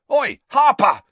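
high, loud shout to distract; desperate yell. A narrator's reading. Seeker shouting at his rival before he catches the snitch, desperate yell. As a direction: yell out urgently to stop a rival. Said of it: "yelled Harry in desperation".

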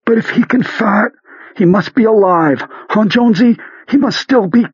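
high voice muffled behind a hand; muffled through hand. Acted. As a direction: talk through a hand over mouth. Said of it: "its words were muffled".

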